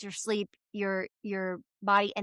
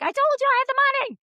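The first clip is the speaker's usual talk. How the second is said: much higher, louder, fast exasperated retort; 14 semitones higher, 5 dB louder, much faster than usual. Retold or imitated. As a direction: rattle it off in mock disbelief. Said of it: "And I was like".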